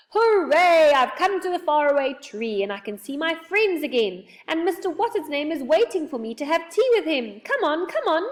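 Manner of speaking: loud, high joyful cheer; shouting in delight. A narrator's reading. Saucepan man setting off to his friend, shouting with joy. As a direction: exult at high pitch, rallying everyone along. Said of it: "shouting in delight".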